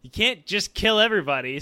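loud scolding emperor. Retold or imitated. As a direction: scold him, fed up. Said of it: "where he's like".

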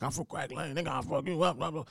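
mock muttered threats. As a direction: brag while walking away. Customer talking shit leaving.

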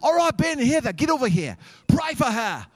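loud, high Australian preacher giving orders. Retold or imitated. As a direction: shout orders in an exaggerated Australian accent. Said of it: "the Australian is like".

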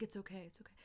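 whispered, gentle comforting; soothing whisper. Retold or imitated. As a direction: hush her like a worried husband. Husband comforting wife, arm around her.

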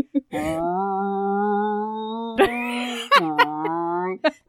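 droning machine hum; dishwasher noise. Retold or imitated. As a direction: imitate a humming appliance starting up. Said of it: "all of a sudden you'll hear, like".

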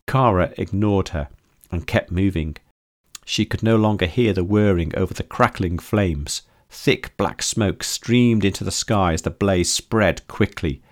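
plain reading voice

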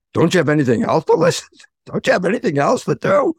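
hammed-up voice in the head; comic inner voice. Inner voice nagging a depressed scientist, played comically. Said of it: "That was the funny part".

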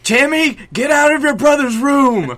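high, loud scolding shout; mock-angry parental yell. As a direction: holler at the kid down the hall. Acts parent yelling at child.

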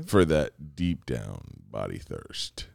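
low, slow ad-read voice; commercial announcer voice. Pretend. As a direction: sell it like a sports drink ad. Mock ad slogan.

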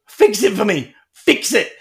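put-on loud, high kid's plea; imitated child's urgent demand. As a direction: insist like an upset boy. Child insisting that dad fix it.

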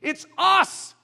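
loud, slow, lifting it up; rising preacher call. Real, not acted. Preacher calling the church to pray, loud and slow. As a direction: build toward the cry.

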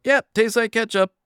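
high, loud, breezy shopper voice; a chirpy little ketchup verdict; mock satisfied shopper. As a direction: play a happy shopper who has been fooled.

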